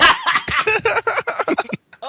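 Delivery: breathless high-pitched laugh; real helpless laugh of disgust; a squealing laugh through the burn; high wheezing laugh. Real, not acted. Laughing after harsh liquor swig.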